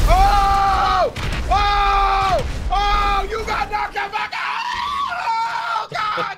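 high, loud whooping celebration; wild hollering, easing at the end; ecstatic shouting. Real, not acted. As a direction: scream in triumph over a big hit. Celebrates a big knockout.

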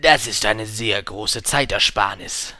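slow, loud salesman voice; put-on merchant's sales talk. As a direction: pitch it emphatically, drawn out. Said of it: "sagte der Händler".

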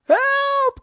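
very high, loud panicked call; cry for help. A narrator's reading. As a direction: shriek out for rescue. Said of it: "cried the witch".

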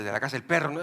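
ordinary delivery

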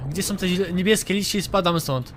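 usual speaking voice

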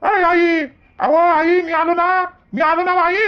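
high, drawn-out call into the house. Acted. Grandson calls for his mother on coming home, calling out.

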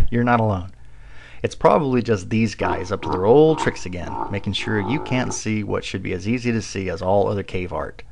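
usual speaking voice

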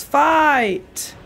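usual speaking voice

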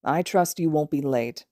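slow, lazy drawl. Acted. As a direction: drag the words out, unbothered. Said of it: "Aizawa drawled to Bakugo".

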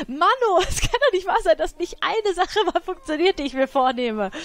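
frustrated laugh-rant; laughing frustration. Real, not acted. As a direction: crack up at the failing plan. Frustrated nothing works.

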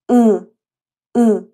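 ا said through the nose as the Turkish letter ı; nasal Turkish letter. Shown as a demonstration. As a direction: voice ا nasally, loud and clear. (ا را از بینی تلفظ کن)

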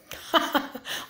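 a short unvoiced scoffing laugh; breathy mocking laugh. Real, not acted. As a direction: laugh at her under your breath. Laughs at her. (ضحكة ساخرة مكتومة)

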